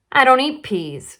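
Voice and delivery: put-on child refusing her peas; stubborn refusal. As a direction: put your foot down like a little girl.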